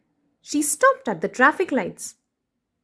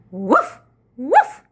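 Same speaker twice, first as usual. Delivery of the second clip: dog barking; 5 dB louder than usual. Acted. Nell barking at the thief, louder. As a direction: bark like an eager dog. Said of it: "She barked".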